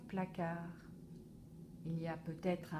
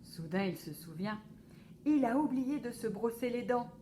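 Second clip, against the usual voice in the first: ordinary delivery, nothing marked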